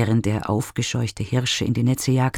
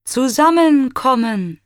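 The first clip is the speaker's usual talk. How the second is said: high, loud echo of the word zusammenkommen; eager echo; 12 semitones higher, 5 dB louder than usual. A narrator's reading. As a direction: ring back zusammenkommen with longing. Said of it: "wiederholt Echo".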